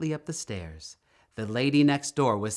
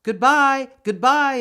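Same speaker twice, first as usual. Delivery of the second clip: high, loud farewell call; shouting goodbye; 12 semitones higher, 9 dB louder than usual. A narrator's reading. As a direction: call it out across the dock. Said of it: "everyone shouted".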